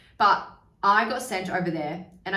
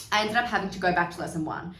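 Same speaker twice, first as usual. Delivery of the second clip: normal talking voice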